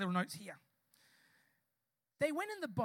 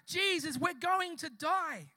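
slow, raised, dramatic plea; retold crying out; 4 semitones higher, 5 dB louder, slower than usual. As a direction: imitate men calling out in a storm. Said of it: "they started to cry out".

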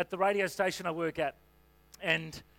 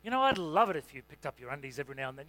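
lower, gentle and sweet; gentle request; 5 semitones lower than usual. Retold or imitated. Carol gently asking her husband about his undies, lower and sweet. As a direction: ask kindly, no blame. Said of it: "that's a different way to say it".

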